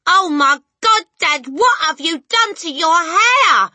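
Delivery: loud, high-pitched aghast yell; shocked shout. A narrator's reading. Son to dad about his hair, shocked shout. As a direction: shout at your dad in disbelief. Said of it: "the son shouted".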